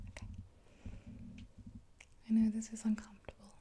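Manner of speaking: normal talking voice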